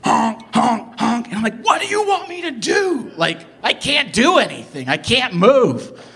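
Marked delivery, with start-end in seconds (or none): 0.0-1.3 repeated high honks; honk, honk, honk like an angry driver leaning on it; aggressive honking imitation. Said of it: "keep aggressive". 1.6-6.0 high-pitched, frustrated protest; acted exasperation, pitch leaping up; exasperated yell. Exasperated reply to honking driver.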